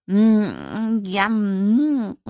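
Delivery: long, high yummy humming; lip-smacking mmm. A narrator's reading. Kira teasing about the delicious food, relishing mmms. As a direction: savour it with exaggerated relish. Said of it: "schmatzte sie".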